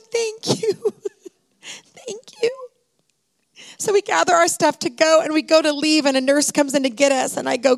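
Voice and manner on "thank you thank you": high, tearful whispered thank you; crying thanks. Real, not acted. Mother thanking the technician with tears streaming down, whispered crying. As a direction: choke out your gratitude through tears.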